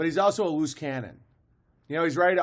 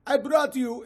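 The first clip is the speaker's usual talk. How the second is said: a higher, lightly put-on broken-English accent; mock broken-English accent; 9 semitones higher than usual. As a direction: parody two characters speaking broken English to each other. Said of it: "have an accent of the same language".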